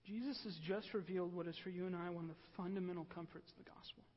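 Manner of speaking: very soft, grave preaching; hushed lament. Real, not acted. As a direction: drop to a near whisper after a sigh.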